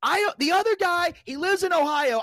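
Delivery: high-pitched, indignant shouting; retold angry yell, stretched at the start; mad outburst. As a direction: go off on him, voice way up. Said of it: "I was so mad".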